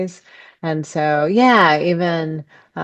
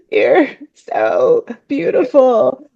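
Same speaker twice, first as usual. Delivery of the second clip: slow, higher, loud tearful wonder at a bluebird; tender awe; 8 semitones higher, 5 dB louder, slower than usual. Retold or imitated. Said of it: "I'm like".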